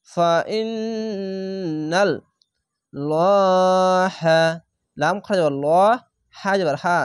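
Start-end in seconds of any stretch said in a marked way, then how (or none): 0.1-2.1 long steady hum in the middle of "ইন্নাল"; obligatory ghunnah. Shown as a demonstration. Teacher joining the spelled word, n in "ইন্নাল" held. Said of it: "এখানে ওয়াজিব গুন্না". 2.9-4.4 "লহা" with a heavy, rounded, very long first vowel; thick laam. Shown as a demonstration. Teacher reciting the example, thick long "লহা". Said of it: "মোটা করে পড়বেন".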